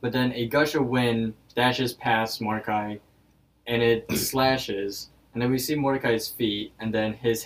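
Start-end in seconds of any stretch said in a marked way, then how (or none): none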